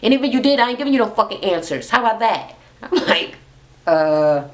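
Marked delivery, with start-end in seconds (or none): 0.0-2.5 high, fast mock tirade; imitated cussing out. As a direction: go off on her, hammed-up. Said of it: "how mad she was".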